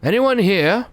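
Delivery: loud high hail into empty room; calling out. Acted. Watson calling into the empty ticket office, loud hail. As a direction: call out to find anyone.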